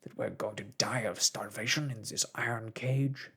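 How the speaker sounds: loud, indignant harpooner; angry complaint. A narrator's reading. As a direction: rail against the cage. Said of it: "said our irascible companion".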